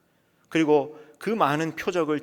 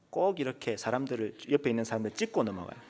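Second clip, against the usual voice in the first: normal talking voice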